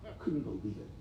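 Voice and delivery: near tears, voice dropping right down; barely getting the words out, overcome; choked up. Real, not acted. Doctor choking up as he tells the crowd about the hospital. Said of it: "I'm about to cry right now".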